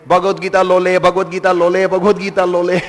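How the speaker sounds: imitating an eager, confused bookseller's chant; enthusiastic street seller. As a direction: call it out over and over like a hawker. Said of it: "So he's going around saying".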